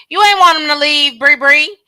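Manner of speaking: "You ain't want him to leave" called out loud. Real, not acted. Repeated louder, calling her out.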